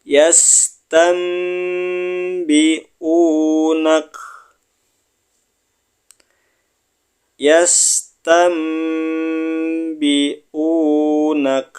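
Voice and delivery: extremely slow, long-drawn chant; Yastambi'unak intoned very slowly; Quran recitation. Shown as a demonstration. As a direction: draw it out like a reciter at the mosque. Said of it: "Kita pindah ke baris berikutnya".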